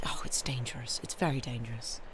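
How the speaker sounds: softly whispered, conspiratorial warning; mock whisper. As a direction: hush it like a confession.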